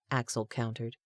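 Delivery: ordinary delivery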